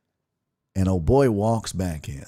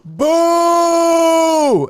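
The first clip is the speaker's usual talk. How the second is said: high, loud booing cry; long piercing boo at the heckler; 7 dB louder than usual. Retold or imitated. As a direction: boo him off like a crowd would. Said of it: "I just go".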